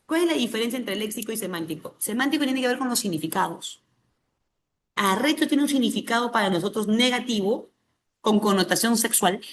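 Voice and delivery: ordinary delivery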